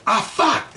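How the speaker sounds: sharp high yelp of pain; retold scream. Bitten by a dog, yelping out in pain. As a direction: yelp it out as the bite hits. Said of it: "And I, like, screamed".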